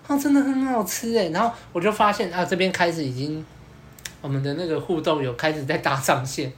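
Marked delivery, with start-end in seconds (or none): 0.1-1.3 put-on delighted girl. Girl voiced enjoying ice cream.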